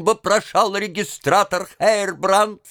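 usual speaking voice

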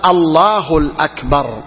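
slow الله الأكبر, linked as "Allahu-l-akbar"; added article. Shown as a demonstration. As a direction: sound the extra l before أكبر in الأكبر. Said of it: "لو قال".

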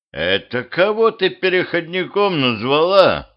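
high, indignant challenge; angry. A narrator's reading. Ex answers the ruler's insult, angry. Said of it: "покраснело от гнева".

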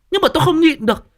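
high, loud, quick retort; indignant protest. Acted. Mother-in-law answering her husband, exasperated protest. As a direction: burst out in mock exasperation.

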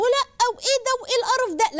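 very high imitation of a girl recoiling; mock disgust. Girls put off salon marriage, mimicked with mock disgust. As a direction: put on a girl's exaggerated revulsion.

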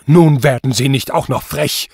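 seething judge's outburst; foaming anger. A narrator's reading. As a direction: snap at the insolent accused. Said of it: "Der Richter schäumte".

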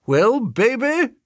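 put-on high, slow coo to a baby; Elizabeth greeting a baby. A narrator's reading. Elizabeth sighting the kid, high drawn-out greeting. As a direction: pitch it up and draw it out.

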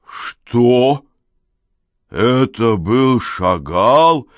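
loud, slow, stunned bellow; yelling like mad. A narrator's reading. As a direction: bellow it in disbelief. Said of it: "Теперь уже Гарик орал, как сумасшедший". (орёт как сумасшедший)

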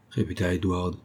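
ordinary delivery

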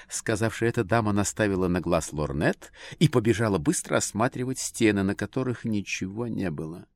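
usual speaking voice

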